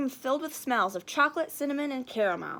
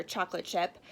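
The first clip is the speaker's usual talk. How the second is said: ordinary delivery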